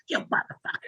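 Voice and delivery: a hushed, breathy stand-in for a yell; whispered mock yell. Comic retelling a rapper yelling from the crowd, whispered. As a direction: hiss the insult instead of shouting it. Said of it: "he started yelling out to me".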